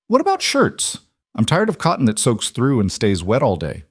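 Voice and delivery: put-on young man with a Scottish accent; light Scottish lilt, starting high. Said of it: "a younger man with a thick Scottish accent".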